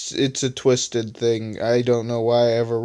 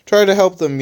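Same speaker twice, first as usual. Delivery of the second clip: mild worried yell; 6 semitones higher, 5 dB louder than usual. Acted. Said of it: "yelled Think Tank worriedly".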